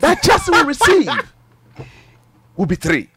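high, loud bold prediction; excited. Real, not acted. Pundit crowing a bold prediction to the hosts. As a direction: crow the prediction, pitch way up.

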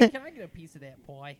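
put-on wholesome 1950s kid. Mock kid voice request.

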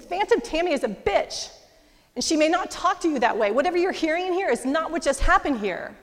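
high and loud, defending his wife; loud protective outburst. Retold or imitated. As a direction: fight for her out loud, fierce not cruel. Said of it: "he's not screaming at me. He's fighting for me".

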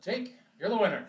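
loud, high announcer call; announcing the winner. Pretend. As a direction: proclaim the winner.

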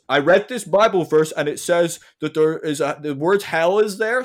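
mock hardliner. The hard-line guest on TV quoting scripture, smug and loud. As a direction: lay down the verse like it settles everything.

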